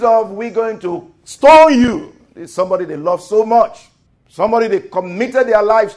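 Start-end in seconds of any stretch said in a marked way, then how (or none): 0.3-2.1 angry threat. Retold or imitated. Men threaten to stone David.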